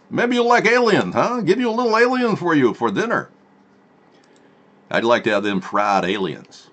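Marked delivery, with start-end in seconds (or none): 0.1-3.3 high hammed-up Russian offer; mock Russian accent. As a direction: offer an alien dinner like a cartoon Russian.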